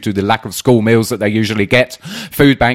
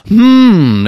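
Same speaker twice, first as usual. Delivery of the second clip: suspicious hmm; 11 semitones higher, 6 dB louder than usual. Acted. Suspicious pause after "then silence.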